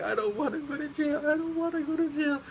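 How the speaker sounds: very high-pitched exaggerated crying voice; mock bawling. Classmate terrified of going to jail, high mock bawling. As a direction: imitate a little girl wailing through tears. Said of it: "She's bawling her eyes out".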